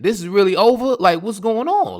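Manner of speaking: mock dismay rising in pitch; dismayed disbelief. Man realising on the phone it's over, acted disbelief.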